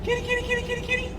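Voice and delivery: very high singsong kitty call; calling a cat. Real, not acted.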